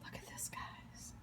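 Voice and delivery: soft breathy whisper of awe. Real, not acted. Cook showing the bowl to viewers, awed whisper. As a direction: whisper it like a secret treasure.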